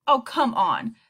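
dismissive. A narrator's reading. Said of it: "answered dismissively".

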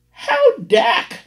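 high, loud, piped "How dark?"; piping landlady. Acted. Landlady on the phone to the African caller, high piping voice. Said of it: "lipstick coated ... piped".

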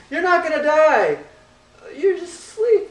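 comically high, loud put-on voice; hammed-up high-pitched reassurance, playing Jesus for laughs; mock Jesus. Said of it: "sounds like Jesus saying".

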